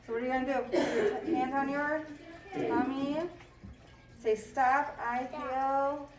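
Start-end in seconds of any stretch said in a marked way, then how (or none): 4.3-6.1 slow calm modeling. Shown as a demonstration. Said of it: "let's pretend to be Camilla and practice calming down".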